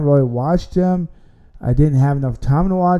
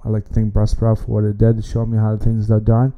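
ordinary talk, nothing marked

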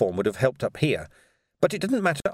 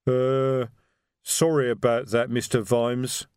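sheepish troll voice, timbre unlike the narrator; a big troll mumbling sorry to his boss; troll apology; slower than usual. Acted.

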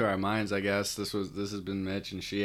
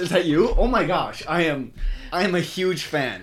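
starstruck boss, louder and higher; gushing fan who recognizes him; excited fan; 9 semitones higher, 11 dB louder than usual. Retold or imitated.

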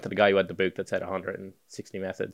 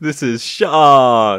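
slow, drawn-out, louder mock gloom; sad, depressed impression; 3 semitones higher, 8 dB louder, much slower than usual. Stand-in impersonates the absent co-host, mock depressed. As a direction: imitate a co-host, dragging the name out miserably. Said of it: "Just sad and depressed".

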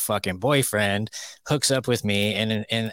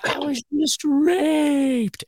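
much higher, slow mocking falsetto of the girl; 3 dB louder, much slower than usual. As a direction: stretch it out in a high, mocking girl voice.

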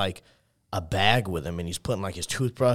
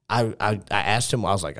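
ordinary talk

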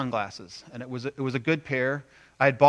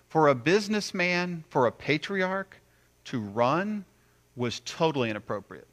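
normal talking voice

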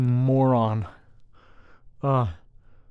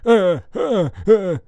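high loud dopey grunts; goofy grunting; 8 semitones higher, 6 dB louder, slower than usual. Retold or imitated. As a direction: grunt like a dim kid. Jeffy-style grunts, high and loud.

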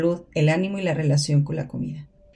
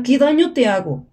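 pleading retort; 6 semitones higher, 5 dB louder than usual. Acted. Sleeper pleads back.

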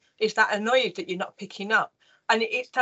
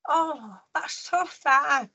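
put-on higher, drawn-out child's sympathy; retold sad child; 5 semitones higher, much slower than usual. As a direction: lightly imitate a saddened little boy, slowly. Retold young son saddened for mother.

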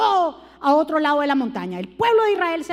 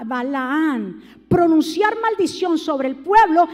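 usual speaking voice

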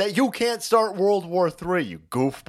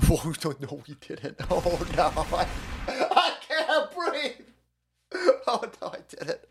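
laughing; 4 dB quieter than usual. Real, not acted. Laughing fit, can't breathe.

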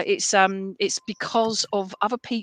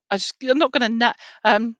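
loud trumpeting elephant noise; 3 semitones higher, 7 dB louder than usual. Acted. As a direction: blast it out like an elephant trunk. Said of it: "that's my elephant impression".

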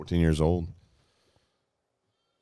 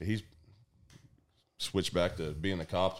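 ordinary delivery

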